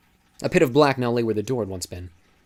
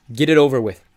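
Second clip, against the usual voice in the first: louder, bitter, resigned; bitter outburst; 4 semitones higher, 10 dB louder than usual. Acted. Thomas to Teresa and Eris, giving up, loud and bitter. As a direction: spit it out and be done. Thomas, eyes shut, gives up.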